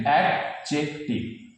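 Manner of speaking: Adjective slowly as অ্যাড-জেক-টিভ; syllable by syllable. Shown as a demonstration. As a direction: break adjective apart with short pauses. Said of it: "syllable ভেঙে ভেঙে বলতে হবে". (ভেঙে ভেঙে, ধীরে উচ্চারণ)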